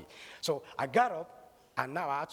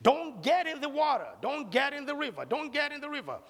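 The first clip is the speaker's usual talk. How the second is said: put-on high, urgent shouted warning to a child; a father calling out in fear for his boy; father's screaming warning; 9 semitones higher than usual. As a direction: yell it across the river at your son. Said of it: "started screaming".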